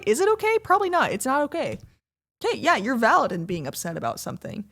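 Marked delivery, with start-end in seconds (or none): 0.1-1.1 mock doubtful. Mock questioning her own line.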